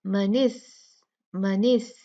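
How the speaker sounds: मनिस with a crisp, airy s at the end; clear s sound. Shown as a demonstration. Teacher modelling a clear sākin sīn, crisp. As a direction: let the s of मनिस hiss clearly but not too long. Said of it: "आवाज़ है ये बड़ी वाज़ेह होनी चाहिए".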